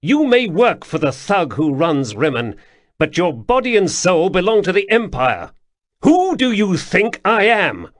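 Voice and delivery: commanding high voice, clipped authority; a young prince pulling rank on a guard captain; imperious prince. Acted. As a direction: loom over him as the prince. Prince asserts authority over captain.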